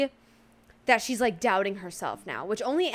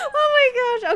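excited; 13 semitones higher, 7 dB louder than usual. Real, not acted. Overwhelmed reaction.

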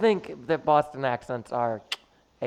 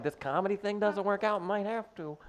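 exaggerated cartoon intonation; 6 semitones higher, 7 dB quieter than usual. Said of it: "You sounded like a cartoon character".

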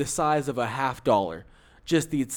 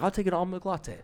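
imitated smiling order; 5 semitones higher, 3 dB quieter than usual. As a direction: lightly mimic a smug customer, a little higher. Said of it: "he smiled when he did it".

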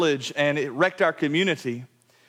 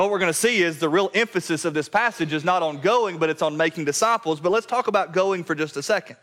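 usual speaking voice

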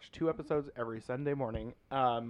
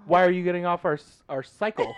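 mock outrage; 5 semitones higher, 8 dB louder than usual. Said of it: "I'm like".

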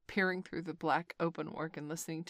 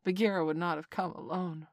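usual speaking voice

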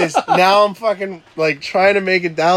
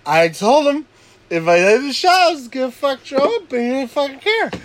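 higher whiny protest; indignant protest; 10 semitones higher than usual. Retold or imitated. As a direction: complain like you were wronged. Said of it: "I'm like".

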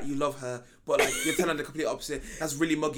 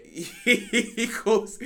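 laughing; 4 semitones higher, much slower than usual. Real, not acted. Laughing while telling.

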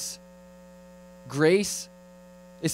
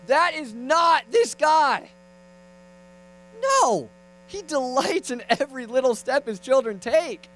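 high, impassioned denial; preacher at full pitch; emphatic preaching; 9 semitones higher, slower than usual. Real, not acted. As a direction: proclaim it with rising conviction. Preacher's emphatic rebuttal of the parody.

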